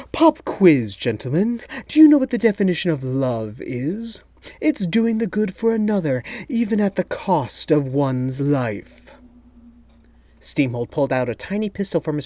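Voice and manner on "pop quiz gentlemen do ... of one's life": a smug mastermind savouring the moment; gloating villain. A narrator's reading. Villain lectures the spies.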